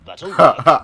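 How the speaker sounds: ordinary talk, nothing marked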